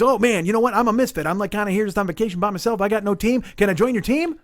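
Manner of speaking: high-pitched, eager self-invitation; enthusiastic appeal. Retold or imitated. Lone stranger asks to join the team, enthusiastic. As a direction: imitate a chatty misfit keen to join. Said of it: "He's all enthusiastic and inspired".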